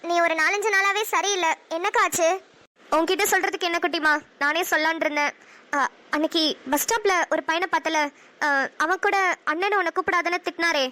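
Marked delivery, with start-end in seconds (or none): none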